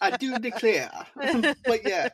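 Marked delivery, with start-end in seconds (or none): 0.0-0.9 light echo of a Southern belle drawl, pitched higher; tossed-back mock Southern drawl. Cohost echoes his friend's impression in a mild Southern drawl. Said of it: "with that southern drawl".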